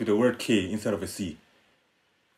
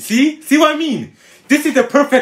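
high, loud protest; indignant vindication; 13 semitones higher, 6 dB louder than usual. Real, not acted. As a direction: make your case loudly, pitch shooting up. Indignant that joke was missed.

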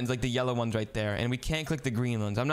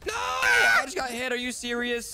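loud, very high wail; dismayed cry; 9 semitones higher, 4 dB louder than usual. Real, not acted. Gets knocked down in game.

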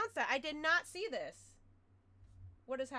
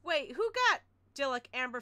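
normal talking voice

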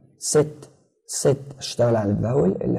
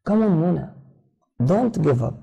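encouraging teacher; 5 semitones higher, much slower than usual. Acted.

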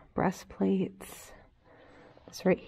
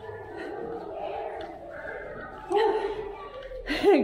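breathless laugh; 12 semitones higher than usual. Real, not acted. Laughs while climbing the tower.

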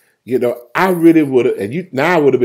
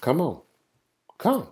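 Jesus; 3 semitones higher, 9 dB quieter than usual. Acted. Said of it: "And Jesus said".

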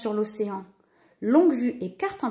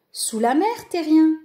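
raised, merry dolphin voice; amused dolphin; 6 semitones higher, 5 dB louder than usual. A narrator's reading. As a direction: tease the land creature cheerfully. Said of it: "rit un dauphin".

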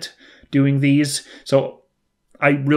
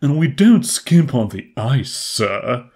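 lightly voiced bellboy; a hint of a servile hotel bellboy; 3 dB louder, slower than usual. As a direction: quote it as a lightly put-on bellboy. Re-performs bellboy's line from game.

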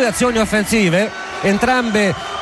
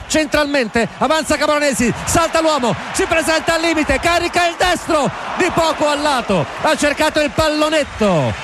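rising, urgent play-by-play, higher; excited commentary; 6 semitones higher than usual. Real, not acted. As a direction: build up as the shot comes. Near miss, rising commentary.

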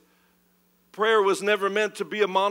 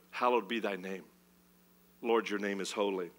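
reverent prayer; 9 semitones lower, 9 dB quieter than usual. Acted.

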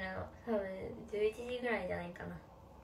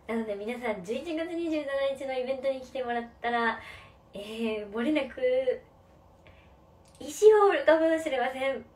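teleshopping pitch; 8 dB louder than usual. Shown as a demonstration. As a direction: hype it up like a TV shopping host. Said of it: "テレフォンショッピングみたいな声".

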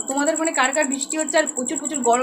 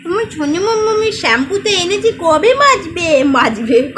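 playful louder sing-song for the daughter; 6 semitones higher, 8 dB louder than usual. Real, not acted. Mother talking to baby daughter.

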